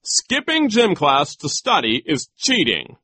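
high, loud, emphatic ruling; pompous insistence; insisting. A narrator's reading. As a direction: lay down the law. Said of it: "Nero insisted".

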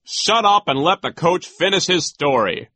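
high, loud rebuke; impatient vice principal; bossy silencing. A narrator's reading. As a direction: order him to shut up. Nero silences the teacher.

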